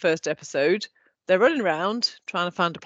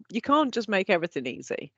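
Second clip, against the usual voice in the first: ordinary talk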